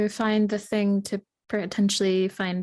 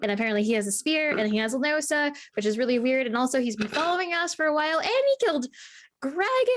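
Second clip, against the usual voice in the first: rising pitch; 7 semitones higher, faster than usual. Acted. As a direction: pitch climbing higher and higher. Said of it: "it just keeps on getting higher and higher pitched".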